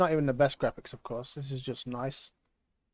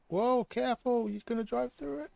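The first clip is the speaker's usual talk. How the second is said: alarmed; 9 semitones higher than usual. Real, not acted. Warning about driving through tornado.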